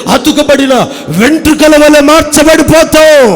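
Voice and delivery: loud, fervent sermon delivery, slowed and stretched; full-force preaching. Acted. Preacher calls congregation to be filled, preaching full force.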